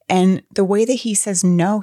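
usual speaking voice

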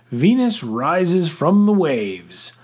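high, slow theatrical declaration; grand proclamation. A narrator's reading. Father admiring his bathing wife, grand proclamation. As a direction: proclaim it grandly, stretching the words. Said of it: "to grandly proclaim".

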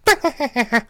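high staccato cackle in bursts; high mock cackle, fired off in runs; imitated cackle. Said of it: "he had a laugh that was like that".

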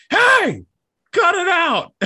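high, loud mimicked reprimand; scolding dog owner. Owner telling off the barking dog, high loud scold. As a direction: yell it sharply at a misbehaving dog. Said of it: "the owner will be like".

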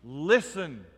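ordinary talk

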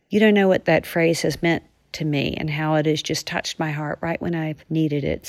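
usual speaking voice

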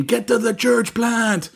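high, drawn-out action-hero catchphrase; Arnold Schwarzenegger. Retold or imitated. Retelling the co-host's Arnold catchphrase, mock action-hero cry. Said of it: "you know how we have Arnold saying".

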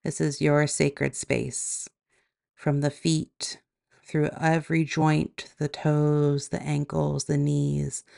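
calm, even meditation voice with long pauses; guided meditation. Acted. Yoga teacher guiding listeners into body and breath, guided meditation. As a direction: guide them gently inward, leaving space between phrases.

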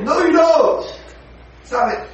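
loud, high rebuke; scolding. Acted. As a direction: tell her off sharply. Scolding the meddler.

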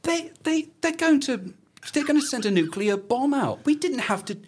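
very high, comic squeal of disbelief; high-pitched hammed-up exasperation; exasperated mock villain. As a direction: protest in a squeaky, put-on voice. Acts out villains' exasperation at plan.

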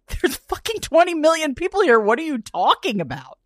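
high, loud, incredulous retort; mock-outraged shout at the absurdity; exasperated outburst. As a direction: blurt it out in disbelief. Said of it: "I'm like".

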